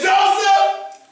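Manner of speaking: high, loud call of the name. Acted. Old father calling Joseph to send him off. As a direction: call the boy across the house. Father calls his son.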